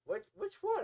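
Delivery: high, puzzled question; puzzled girlfriend. Retold or imitated. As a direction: ask it surprised and up high. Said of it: "And she's like".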